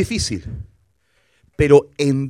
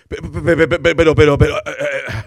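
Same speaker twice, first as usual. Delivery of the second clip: stammering scared; 2 semitones lower, 4 dB louder than usual. Retold or imitated. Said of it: "puso una cara así como asustado".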